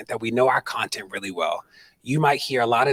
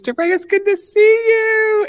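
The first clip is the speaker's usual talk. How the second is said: mock official; 10 dB louder, slower than usual. Attorney general brushes him off.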